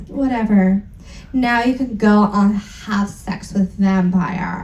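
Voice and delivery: ordinary delivery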